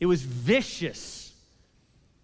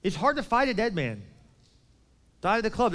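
ordinary talk

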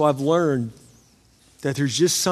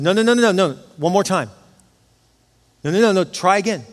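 higher, louder run of no's; urgent insistence; 4 semitones higher, 4 dB louder than usual. Retold or imitated. Husband begs the sonographer not to stop looking, urgent insistence. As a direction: stop them leaving, insistently. Pleading with sonographer to keep trying.

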